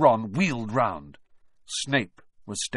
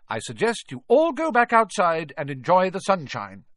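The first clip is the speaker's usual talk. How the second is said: higher, louder, brisk order; firm dismissal; 8 semitones higher, 5 dB louder than usual. A narrator's reading. McGonagall sends pupils back outside, firm dismissal. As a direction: send them away firmly.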